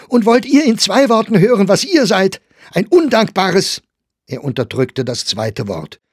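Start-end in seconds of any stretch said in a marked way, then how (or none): 0.1-3.8 high, very loud retort, broken off; angry shouting. A narrator's reading. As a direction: shout back, stop short of the insult. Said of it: "rief Meyer". (zornig schreiend, dann abgebrochen)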